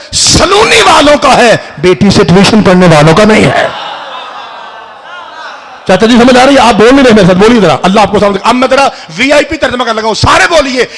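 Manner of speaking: loud, rousing sermon voice, pressing the crowd; fiery preaching. Real, not acted. Preacher to majlis crowd, demanding answers, full-force preaching.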